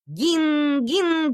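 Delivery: high hallooing call; animals calling. Acted. Owl and duck calling for the fisherman, high halloo. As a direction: call out far across the rock. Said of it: "кричали Бумба и Кика".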